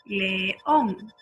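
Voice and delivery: "león" with ÓN lengthened; "león", weight on the final ÓN; stressed syllable held long. Shown as a demonstration. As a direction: say "león" slowly, leaning on ÓN. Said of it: "la sílaba que yo estoy diciendo largo".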